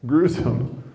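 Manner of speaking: ordinary delivery, nothing marked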